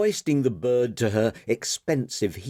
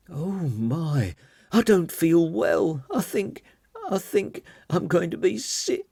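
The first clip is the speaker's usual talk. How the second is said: higher, unsteady ladylike voice; sick woman voice; 8 semitones higher than usual. Acted. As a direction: play a lady about to be ill. Margot feeling suddenly sick.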